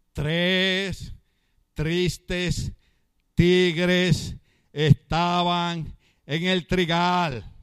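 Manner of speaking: slow word-by-word tongue twister with every R sounded; wife's diction drill. Retold or imitated. Wife coaching him to repeat, slow careful diction. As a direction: model each word slowly, "tres... tristes... tigres". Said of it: "mi esposa me decía, repite conmigo".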